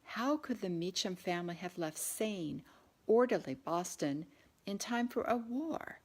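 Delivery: ordinary delivery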